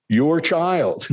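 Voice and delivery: lightly voiced guru reassuring a student; Gurudev. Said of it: "The answer is".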